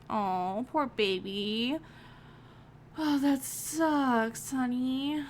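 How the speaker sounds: slow, cooing sympathy; tender sympathy. Real, not acted. Comforting game daughter.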